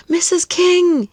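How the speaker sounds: high, eager call to the teacher; excited cry. Acted. Boy calling his teacher over, excited. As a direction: call out to her, thrilled. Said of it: "he cried".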